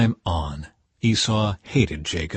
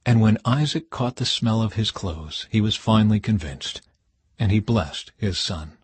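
plain reading voice

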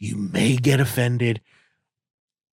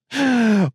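mock gasp; 9 semitones higher than usual.

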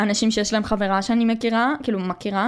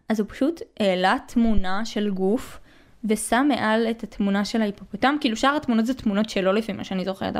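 ordinary talk

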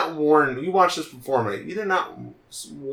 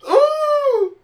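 excited squeal; 7 dB louder than usual. Real, not acted. Excited about cute cat.

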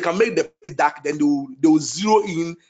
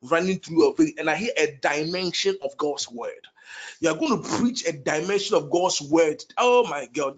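ordinary delivery

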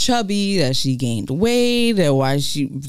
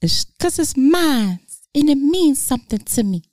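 acted hurt plea; 9 semitones higher than usual. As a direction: play it upset, pitched up. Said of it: "she's wearing the necklace that T I gave her".

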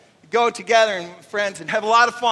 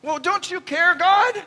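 mock plea; 10 semitones higher, 4 dB louder than usual.

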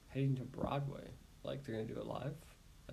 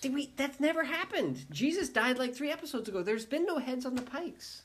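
exasperated disbelief; 9 semitones higher than usual. Real, not acted. Viewer protests to friend about spoilers, pitched-up exasperated disbelief. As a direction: protest that it never happened, voice climbing. Protests the pikes never happened.

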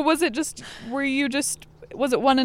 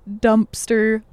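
ordinary delivery

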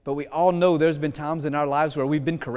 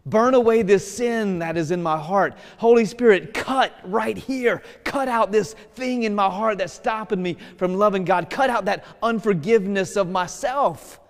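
raised, louder pleading prayer; heartfelt prayer; 4 semitones higher, 4 dB louder than usual. Shown as a demonstration. Preacher showing the congregation how to pray, fervent plea. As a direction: cry out to the Spirit to burn the sin away. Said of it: "I'm gonna say".

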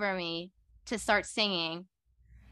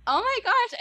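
much higher and louder, gushing; excited squeal; 13 semitones higher, 6 dB louder than usual. Retold or imitated. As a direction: squeal it in disbelief. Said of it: "I was just like".

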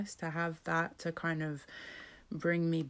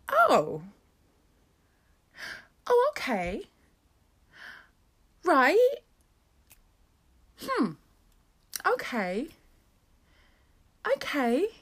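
much higher, hesitant little reactions; mock bewildered; 9 semitones higher, 5 dB louder, slower than usual. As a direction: play dazed surprise, pausing between each okay. Stunned reaction to being loved.

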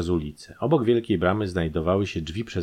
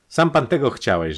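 raised, high retort; forceful retort; 7 semitones higher, 5 dB louder than usual. A narrator's reading. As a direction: throw it back at the prisoner loudly. Captor after calling the police.

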